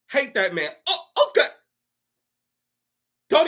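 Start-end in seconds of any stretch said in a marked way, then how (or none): 0.9-1.5 high-pitched dopey compliance; mock mindless agreement. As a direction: squeak out instant obedience.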